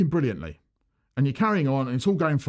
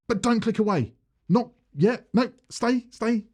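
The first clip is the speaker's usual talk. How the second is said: mock pleading; 8 semitones higher than usual. Begging viewers not to leave.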